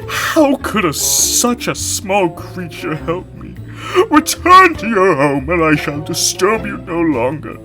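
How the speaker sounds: loud, despairing lion cry; a trapped lion sending the mouse away; shouting lion. A narrator's reading. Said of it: "the lion shouted".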